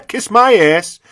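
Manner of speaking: high, loud mocking retort; defiant retort. Vlogger retells telling a mask clerk off, loud and mocking. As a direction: fling it back with scorn.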